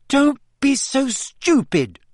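high, slow scolding; offended, pitched right up; indignant retort. A narrator's reading. Acted quarrel, stung retort.

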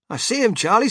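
high, loud eager reply; excited. A narrator's reading. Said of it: "said Grandpa Joe excitedly".

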